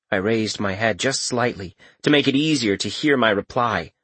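ordinary delivery

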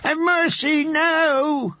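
high, desperate scream for mercy. Acted. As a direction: cry out to the heavens, begging. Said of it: "he screamed".

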